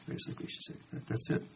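whispered aside; dropped to a whisper. Real, not acted. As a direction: let it fall to a whisper. Preacher's aside drops away.

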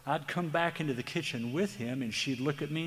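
usual speaking voice

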